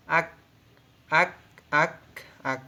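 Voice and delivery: আক with a clipped final k, said slowly and repeated; teacher shows the clipped final sound in আক; batchim demo. Shown as a demonstration. Teacher drills the Korean final consonant for students, carefully. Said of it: "এই যে উচ্চারণটা এভাবে হবে".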